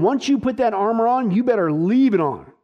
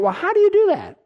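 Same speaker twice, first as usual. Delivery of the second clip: high, puzzled questioning voice, much higher than his own; skeptical listener; 12 semitones higher, faster than usual. Retold or imitated. Preacher voicing a doubting listener, high puzzled question. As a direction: play the doubter in the pews asking how. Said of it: "the question is".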